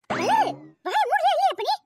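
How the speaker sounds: high, loud furious yell; angry scolding shout. Acted. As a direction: shout her down. Friend shuts up the quarrelling girl. (கோபத்தில் கத்தி அதட்டுவது)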